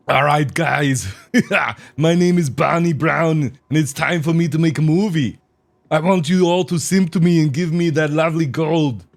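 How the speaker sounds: cheesy streamer persona. Acted. Streamer mocks a begging e-girl persona asking for gold, hammed-up loud. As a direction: oversell it like a money-begging host. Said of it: "be like".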